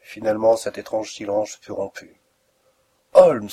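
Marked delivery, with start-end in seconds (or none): 3.1-3.5 loud, urgent call to wake a sleeper; insistent call. A narrator's reading. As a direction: rouse a sleeping man by name. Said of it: "du ton insistant de qui réveille un homme endormi".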